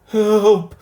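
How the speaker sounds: very high, very loud howl; howl for help. A narrator's reading. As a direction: scream for help in panic. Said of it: "howled Timothy".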